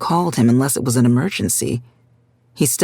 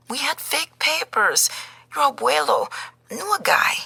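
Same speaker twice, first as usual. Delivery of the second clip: put-on higher, softer, confessing old woman; tearful grandmother; 12 semitones higher, 4 dB quieter than usual. A narrator's reading. Grandmother confessing fake papers to Gabriel, higher tearful voice. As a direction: admit it with shame.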